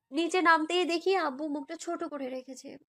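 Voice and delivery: ordinary delivery, nothing marked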